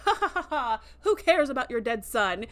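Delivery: cruel cackling laugh, then a sneer; mocking laugh. Braca laughs at the grieving mother, mocking.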